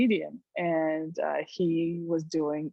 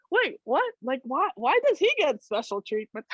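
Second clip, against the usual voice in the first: indignant surprise; 6 semitones higher than usual. Retold or imitated. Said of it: "It's like".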